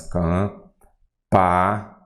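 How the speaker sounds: "campainha" broken into cam, pa, i, nha with long pauses; very slow "campainha", one syllable at a time. Shown as a demonstration. Teacher sounding out a word for students, "campainha" syllable by syllable. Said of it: "fala assim".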